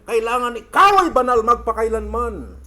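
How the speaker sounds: exhortation building to a loud peak; forceful preaching. Real, not acted. Preacher urging the congregation to stay holy forever, forceful. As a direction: drive it home, swelling on the final sentence.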